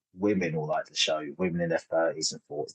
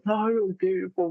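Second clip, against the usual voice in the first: higher-pitched imitation of an overcome wife; imitating emotional outpouring; 12 semitones higher than usual. Wife hugging the comedian after the show, imitated emotional outpouring. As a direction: act out her gushing, much higher. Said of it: "her doing this outpour".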